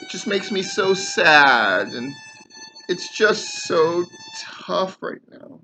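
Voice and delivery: milk the fake sorrow, dragging it out; mock whining. Ranter mocks wrestlers' pity tour, slow sarcastic whine.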